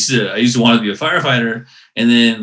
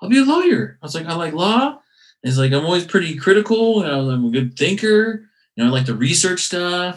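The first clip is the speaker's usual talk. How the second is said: eager younger self; 9 semitones higher than usual. Retold or imitated. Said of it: "And I was like".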